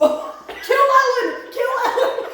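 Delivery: high, loud, drawn-out shout; gleeful shout. Real, not acted. Answering the fuck-marry-kill game, gleeful loud shout. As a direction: yell the verdict with delight.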